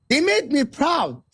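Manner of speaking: usual speaking voice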